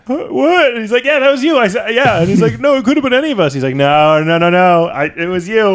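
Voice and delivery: loud, high squabble; mock argument. Squad members arguing over whose bullet, loud and high. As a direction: bicker loudly like guilty soldiers.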